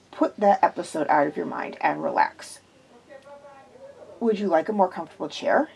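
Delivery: low, gentle and soothing; calm her down kindly. A narrator's reading. Quillian soothes the frightened woman, low and gentle. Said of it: "in his most soothing way".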